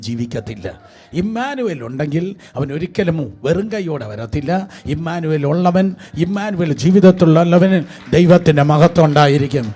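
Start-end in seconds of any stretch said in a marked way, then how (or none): 4.9-9.7 building to a full-voiced shout, much louder; preacher swelling into a roar over the crowd; rousing preaching shout. Real, not acted. Preacher to congregation, urging them to clap: building to a loud shout.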